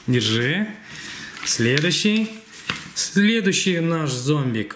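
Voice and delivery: normal talking voice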